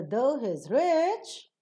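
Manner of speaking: slow, "rich" stretched and climbing high. Shown as a demonstration. Teacher shows an incomplete utterance, rising on "rich". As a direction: lift "rich" to sound incomplete. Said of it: "the moment you raise up in your speech".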